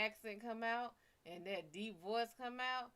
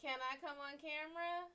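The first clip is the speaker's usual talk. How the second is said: celebrity request; 8 semitones higher than usual. Acted. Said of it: "It was like".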